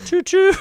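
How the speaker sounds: high mock train whistle; train imitation. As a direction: play a toy train.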